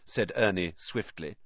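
plain reading voice

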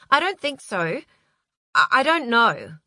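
high, stammering uncertainty; rattled. A narrator's reading. Appalled assistant answering during seizure.

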